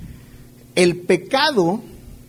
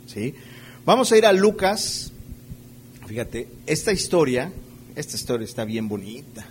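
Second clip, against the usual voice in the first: usual speaking voice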